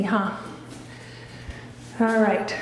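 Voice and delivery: ordinary talk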